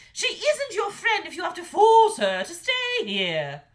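high, loud scornful mother; scornful dragon mother. A narrator's reading. Mother dragon sneering at her son's princess, high and loud. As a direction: belittle his choice with contempt.

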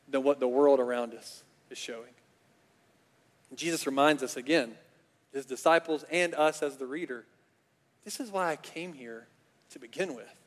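ordinary talk, nothing marked

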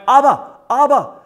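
put-on high, loud child's call of "Abba, Abba"; crying out Abba. Kids in Israel crying for father, loud high calls. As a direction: call "Abba" like a kid in the street. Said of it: "they're crying".

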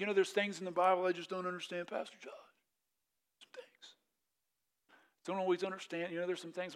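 a lightly voiced puzzled churchgoer fading to a mumble; whiny complaint. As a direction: play the confused churchgoer, a touch whiny. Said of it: "people sometimes are like".

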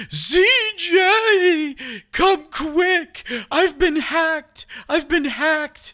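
high, slow, frantic mom voice; imitating crying mom. Son mimicking his frantic mother on the phone, high and slow. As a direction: squeal it out as a panicked mother. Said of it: "That's not what your mom sounds like".

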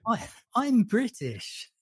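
British caricature, slow and lilting; mock British accent. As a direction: put on an exaggerated Hollywood idea of British. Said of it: "they normally sort of speak".

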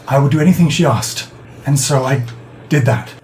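put-on halting, rueful old man's confession; remorseful old man. Old man explaining the barrel to his victim, ashamed. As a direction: confess in pieces, pausing as guilt catches. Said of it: "He nods and says".

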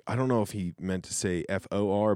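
ordinary delivery

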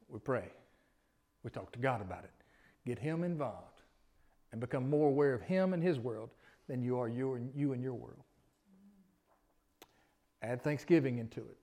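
ordinary delivery

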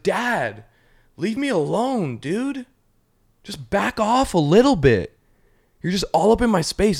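put-on high, drawn-out teen complaint; annoyed teenager. Teenage cat telling Dad to back off, high exasperated whine. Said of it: "as they get into their teenage years".

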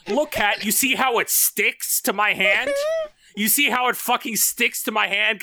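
high, gleeful showing off; gloating demo voice; acted show-off. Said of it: "and seeing".

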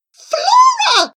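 very high, loud cry of the name; shrieked amazement; amazed gasp. A narrator's reading. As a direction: exclaim in overwhelmed astonishment. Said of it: "gasped Charlie".

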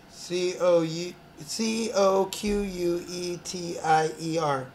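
slow letter-by-letter spelling; slow deliberate spelling. Shown as a demonstration. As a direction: spell "C O Q U E T I E R" slowly. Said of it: "you want me to spell it".

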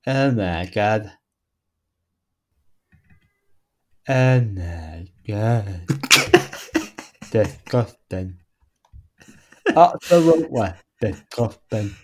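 slow, quiet, low mock disgust; put-on disgusted voice. Reacting to a sexology article, slow low mock disgust. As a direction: drag each word out softly, appalled. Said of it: "read an article out in that voice".

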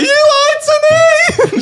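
drawn-out, very high scream; hammed-up shrieking accusation; massive meltdown. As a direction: scream it like a betrayed child. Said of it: "like, massive meltdown".

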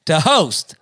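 loud, high, energetic show host; hyped podcast host. Acted. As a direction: kick off the segment like an announcer. Hosting the podcast segment.

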